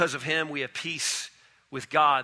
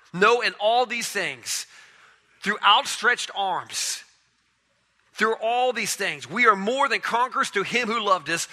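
slow, rising preacher's cry, held high; preaching build; 4 semitones higher than usual. Acted. Preacher to congregation, slow and lifted. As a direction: lift the congregation with each phrase.

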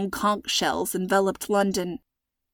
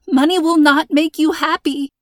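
higher, louder urgent insistence; anxious preaching; 7 semitones higher, 5 dB louder than usual. A narrator's reading. Hostage evangelizing to her captor, high and urgent. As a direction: press the point nervously.

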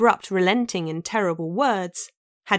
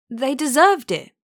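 higher, louder, blurted in panic; stammering; 6 semitones higher, 4 dB louder than usual. A narrator's reading. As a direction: stammer it out, cornered and scared. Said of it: "she stammered".

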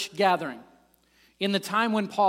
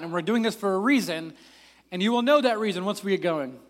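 usual speaking voice

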